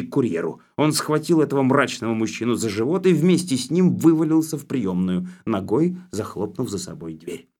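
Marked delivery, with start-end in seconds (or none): none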